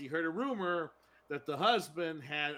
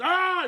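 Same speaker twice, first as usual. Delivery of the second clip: sudden high, loud scream of terror; retold scream of fright; 13 dB louder than usual. Farmer sees the dead husband's ghost, loud terrified scream. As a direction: yelp out in shock as if seeing a ghost. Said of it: "He goes".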